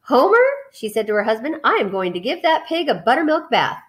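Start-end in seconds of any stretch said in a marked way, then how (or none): 0.0-0.4 high, loud call across the kitchen; calling Homer from the kitchen. Acted. Wife calling husband. 1.6-3.8 announcement. Acted. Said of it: "made an announcement".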